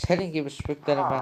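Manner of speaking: ordinary talk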